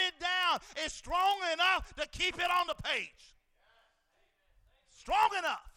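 very loud, high shouted praise; booming pulpit cry; preaching at full force. Acted. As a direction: raise the roof with it.

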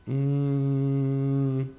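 long, high, held "mm"; pondering hum. Pretend. Podcaster pondering favorite superhero, long thinking hum. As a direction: hum on one note while deciding.

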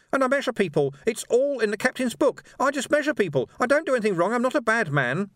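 very high, pleading little man; frightened little clerk. A narrator's reading. Struggling clerk excuses himself to Vimes, high frightened pleading. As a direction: plead your innocence in a high, panicky voice.

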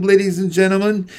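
fast, tossed-off ladies and gentlemen; quick aside. A narrator's reading. Announcer hurries toward the punchline, fast address. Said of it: "In the words of the spider's web".

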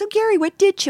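high-pitched mock quote of her; imitating a woman's question. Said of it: "And she goes".

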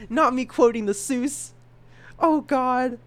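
high, loud voice between laughs; amused horror. Real, not acted. Podcaster caught quoting Doctor Seuss, amused horror. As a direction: cry out high, cracking up at yourself.

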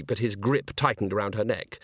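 ordinary delivery

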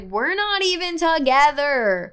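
slow indignant retort, retold; exasperated protest. Girlfriend answering his complaint, retold loud exasperation.

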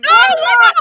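high, loud outraged protest. Real, not acted. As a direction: shout the objection, high and indignant. Protesting the speakerphone ruling.

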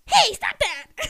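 high, loud, drawn-out mock scolding; imitated sister's shout. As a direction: yell like a sister breaking up a fight. Older sister interrupts the choking.

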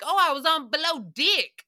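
loud, high mock Aussie accent; Australian accent. Host imitating Below Deck crew, exaggerated Australian accent. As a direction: put on a broad Australian twang. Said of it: "do Australians and New Zealand".

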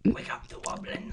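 soft unvoiced whisper. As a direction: breathe it out eerily. Whispered aside.

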